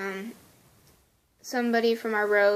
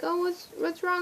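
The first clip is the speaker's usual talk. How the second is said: higher, worried-sounding question; high, solicitous asking; concerned stranger; 8 semitones higher, 3 dB quieter than usual. Retold or imitated. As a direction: imitate someone checking on a crying person. Strangers asking crying girl.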